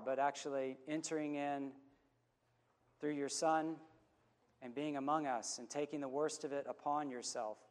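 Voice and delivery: low, unhurried prayer voice; closing prayer. Real, not acted. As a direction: pray over the congregation, slowing down. Pastor leads closing prayer.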